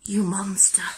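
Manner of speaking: breathy mock accusation whispered; whispering. Real, not acted. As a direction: whisper it like a curse. Whispered after losing.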